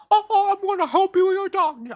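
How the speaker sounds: high, loud squeaky cartoon falsetto; bad Mickey Mouse. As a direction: attempt a high-pitched cartoon mouse and miss. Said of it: "I can't fucking do a Mickey accent".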